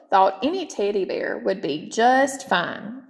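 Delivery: ordinary delivery, nothing marked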